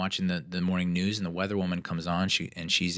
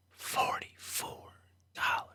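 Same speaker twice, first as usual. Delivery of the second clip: even softer, slower whisper; fainter whisper; 6 dB quieter, slower than usual. Real, not acted. Repeating the price to herself, a fading whisper. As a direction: let the repeat fade to almost nothing.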